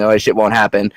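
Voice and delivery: usual speaking voice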